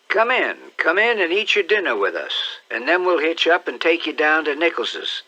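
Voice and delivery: put-on high, bright-eyed old aunt calling out; a fussy country aunt, curious and excited; high-pitched eager aunt peering out the window; Aunt Sally. Aunt Sally invites stranger.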